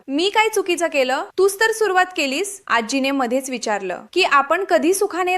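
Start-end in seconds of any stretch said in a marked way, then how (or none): 0.1-2.6 higher-pitched, mildly innocent protest; a touch of wide-eyed self-defence. A narrator's reading. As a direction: plead innocence lightly, pitch lifted. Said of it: "निरागसपणे उत्तर दिलं".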